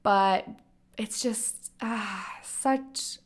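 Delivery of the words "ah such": normal talking voice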